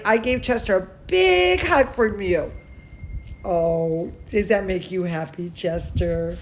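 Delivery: usual speaking voice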